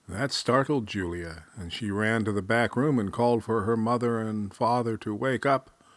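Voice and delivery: usual speaking voice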